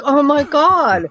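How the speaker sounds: drawn-out, high-pitched dismay; retold gasp of horror, stretched slowly; shocked. Retells her shocked reaction to theft.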